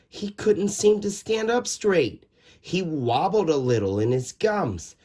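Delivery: ordinary delivery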